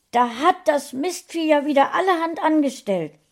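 höher und lauter geschimpft; über die Katze ärgern, laut und hoch; verärgertes Schimpfen. A narrator's reading. (laut und hoch schimpfen)

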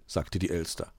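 ordinary delivery

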